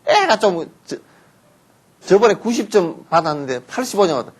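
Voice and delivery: usual speaking voice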